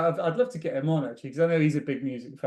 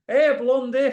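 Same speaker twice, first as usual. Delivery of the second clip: Brian Clough's call; 9 semitones higher, 6 dB louder, slower than usual. Retold or imitated. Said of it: "from Brian Clough".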